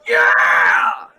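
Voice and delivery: high, roaring cheer; big, drawn-out tavern yell; excited shout. Acted. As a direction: whoop it out in triumph. Innkeeper cheers at the answer.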